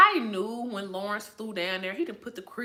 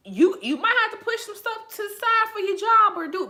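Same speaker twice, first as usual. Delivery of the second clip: heated outburst; 12 semitones higher, 8 dB louder than usual. Real, not acted. Lecturing Lawrence on responsibility.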